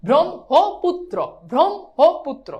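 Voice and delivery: slow, punched "brom... ho... putro" for ব্রহ্মপুত্র; teacher's deliberate repeat drill of ব্রহ্মপুত্র; syllable-by-syllable demo. Shown as a demonstration. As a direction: separate every syllable of ব্রহ্মপুত্র. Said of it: "তাহলে কি হবে?".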